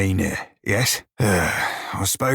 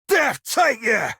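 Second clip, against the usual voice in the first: much higher, slow furious roar; roaring curse; 10 semitones higher, slower than usual. Acted. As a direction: bellow it at the foe. Threat to an enemy, full roar.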